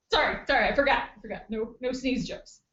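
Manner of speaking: ordinary talk, nothing marked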